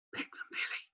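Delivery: breathy urgent whisper. Acted. Pelican whispering to Billy in the tree, urgent whisper. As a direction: hush it so the Duke can't hear. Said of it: "he whispered".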